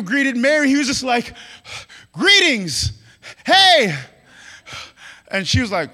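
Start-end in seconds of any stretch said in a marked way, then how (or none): 2.1-3.9 loud, bursting hello; overexcited greeting. Acted. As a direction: greet with far too much energy. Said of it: "Why are you too excited?".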